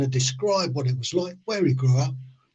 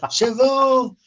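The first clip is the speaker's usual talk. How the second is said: high, loud call in mangled French; shouting across yard; 11 semitones higher, 5 dB louder, much slower than usual. Retold or imitated. Driver greets French charge hand across the yard, high shout. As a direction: holler it cheerily to someone far off. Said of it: "I shout across the yard".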